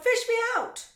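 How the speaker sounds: high-pitched loud yell; crying out. A narrator's reading. Boy in the river begging rescue, crying out. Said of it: "he cried".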